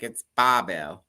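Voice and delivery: New York accent, slow and low. Shown as a demonstration. Showing her cohost the name in a New York accent. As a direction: drawl "Bobble" New York style. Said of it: "Just say it like a New Yorker".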